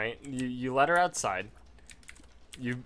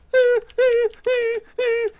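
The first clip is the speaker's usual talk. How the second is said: manic laugh; 12 dB louder than usual. Acted. Mock rage at dog.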